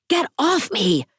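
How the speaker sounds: a high, sharp yell to break free. A narrator's reading. Grieving widow yelling at her captors. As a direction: shout it at the men holding her. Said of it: "I yell".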